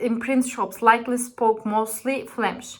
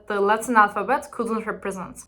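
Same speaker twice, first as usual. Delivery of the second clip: ordinary delivery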